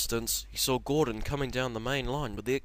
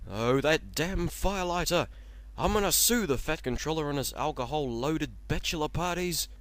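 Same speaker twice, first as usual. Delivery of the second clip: angry rant; 6 semitones higher, 5 dB louder than usual. Acted. Henry raging at the firelighter and fat controller, angry rant. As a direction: rage at the drunk firelighter.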